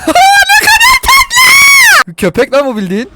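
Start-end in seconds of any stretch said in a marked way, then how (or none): 0.1-2.1 very high, slow, loud squeal through laughter; squealing delight. Real, not acted. Gamer squealing with joy at his new pet wolf. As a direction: squeal like seeing a puppy. Gushing over the tamed wolf.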